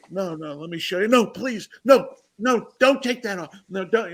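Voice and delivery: mock desperate pleading; hammed-up begging. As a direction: beg her to stop, half joking. Begging her not to remove.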